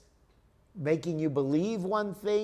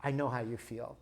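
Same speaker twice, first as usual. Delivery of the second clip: softer, lower, gentle reassurance; empathetic; 5 semitones lower, 6 dB quieter than usual. Shown as a demonstration. Husband repeating comfort to upset wife, soft and empathetic. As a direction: say it softly and low, just to comfort. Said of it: "that's all the emotional person wants".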